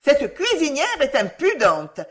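high, loud outcry; indignant cry. A narrator's reading. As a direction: cry out in indignation as a crowd of pupils. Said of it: "crièrent les trente pensionnaires".

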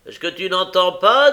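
high, loud scolding question; impatient shout. A narrator's reading. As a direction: shout at someone who won't wake. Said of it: "dit la même voix".

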